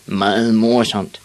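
slow, sour mock laugh and grumble. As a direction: grumble it as if not amused at all. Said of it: "sagde de surt".

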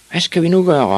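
high, loud worried call; shouted. Acted. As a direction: cry out to the crowd for help. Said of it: "råbte de".

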